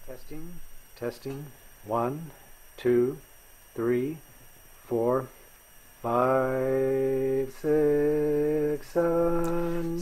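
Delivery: put-on thin tinny old radio sound; like a small AM radio speaker. Shown as a demonstration. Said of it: "this is what it would sound like".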